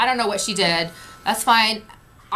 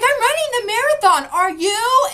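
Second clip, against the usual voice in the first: high, raised-voice challenge; mock defiant holler; retold indignant retort; 11 semitones higher, 4 dB louder than usual. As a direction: imitate a man arguing back at a crowd. Line cutter arguing with shouting crowd.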